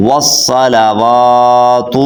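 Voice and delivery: slow tajweed model of والصلوات; correct long waw. Shown as a demonstration. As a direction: chant والصلوات slowly as "was-salawaatu", holding "waa". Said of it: "کیا پڑھنا؟".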